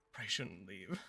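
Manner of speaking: whispered to herself. Real, not acted. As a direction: breathe it out unvoiced. Whispering to self.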